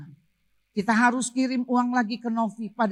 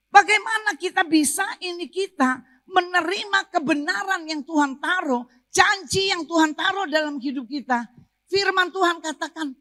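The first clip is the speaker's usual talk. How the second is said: high-pitched, driving sermon delivery; forceful preaching; 8 semitones higher, 3 dB louder than usual. Real, not acted. Preacher urges congregation to keep declaring God's promise, forceful preaching. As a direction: preach with insistent, rising force.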